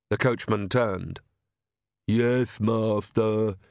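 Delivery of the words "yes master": thick-tongued servile henchman; Igor. A narrator's reading. Coachman answering his master, servile henchman voice. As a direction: answer slowly as a dutiful minion. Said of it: "said Igor".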